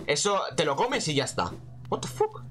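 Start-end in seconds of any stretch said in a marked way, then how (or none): 1.9-2.4 what the fuck agudo y susurrado; sorprendido. Real, not acted. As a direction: sorpresa incrédula, casi sin voz. Sorpresa ante una trampa. (sorpresa aguda y susurrada)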